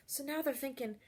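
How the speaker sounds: plain reading voice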